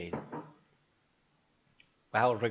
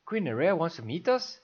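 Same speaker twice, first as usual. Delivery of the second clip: higher startled question; surprised; 7 semitones higher, 4 dB louder than usual. Acted. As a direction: ask it in astonishment.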